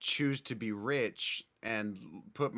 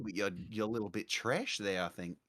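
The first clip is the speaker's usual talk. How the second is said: a hint of Australian accent, a bit lower; light Aussie accent; 5 semitones lower, 6 dB quieter than usual. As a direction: casually tease in a faint Aussie accent. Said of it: "call me trash in an Aussie accent".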